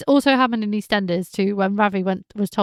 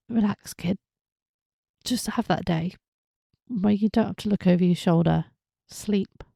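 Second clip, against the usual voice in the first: soothing. Real, not acted. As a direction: ease him down like a worried parent. Soothing the character Dylan.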